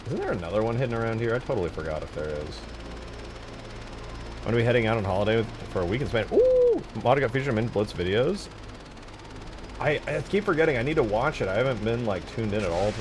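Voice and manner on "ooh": high, loud ooh of surprise; excited ooh. Real, not acted. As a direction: let out a soaring ooh. Surprised by news he reads.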